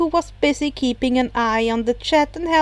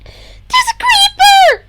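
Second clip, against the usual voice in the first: much higher, loud panicked screech; 9 dB louder, slower than usual. Acted. Himiko fleeing a creeper, screeching for help. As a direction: shriek the warning in panic. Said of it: "Himiko screeched".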